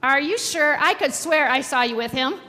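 loud, dramatized storybook insistence; insistent accuser. A narrator's reading. Pastor voices the man pressing Peter further, insisting.

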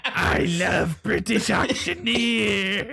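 slow, high eerie creature. Acted. GM repeats the monster line.

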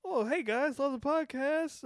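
high, mocking sing-song show-off voice; mock bragging listener reading his email, pitched high.

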